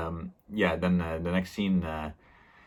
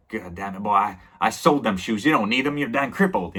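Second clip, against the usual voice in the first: higher and louder, scolding; angry uncle; 4 semitones higher, 4 dB louder than usual. Retold or imitated. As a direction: snap at the boy, voice rising. Uncle scolds the crippled nephew.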